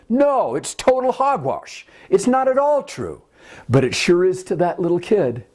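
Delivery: ordinary delivery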